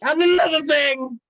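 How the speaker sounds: loud, high shout; indignant shout. Acted. Balthazar angrily raising his grievance, shouting. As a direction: bellow the complaint in full outrage.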